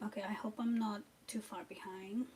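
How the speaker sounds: unvoiced whisper aside to herself. Real, not acted. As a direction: murmur it under your breath.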